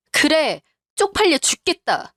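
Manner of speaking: high-pitched, fed-up comeback; exasperated retort. Acted. Wife answering her wounded husband, exasperated. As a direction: throw it back at him, pitched high. Wife snaps back in quarrel.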